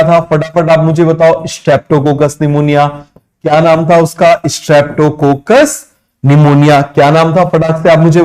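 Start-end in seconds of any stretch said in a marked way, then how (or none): 1.5-2.9 slow dictation. Shown as a demonstration. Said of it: "dictating the answer". 4.4-6.8 slow dictation. Shown as a demonstration. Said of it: "dictating the answer".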